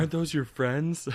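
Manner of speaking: mock-innocent jab, voiced high; teasing waiter. Restaurant staff voiced in retelling.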